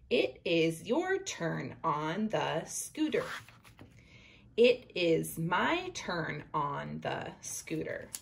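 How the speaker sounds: slow, careful read-aloud; reading to children. Acted. Teacher reading the picture book aloud to her class, slow. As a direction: read it slowly for young readers.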